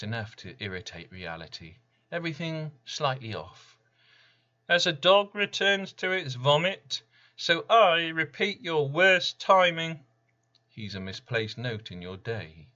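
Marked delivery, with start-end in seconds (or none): 4.7-10.0 high, loud, eerie mockery; Random's voice. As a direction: recite a proverb as a gloating spirit. Random mocks with a proverb.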